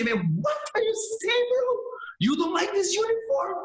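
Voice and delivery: indignant high shout at teammates who dislike the uniform; outraged retort. Retold or imitated. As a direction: fire back in shocked outrage at the gi snub. Acting his outraged reply.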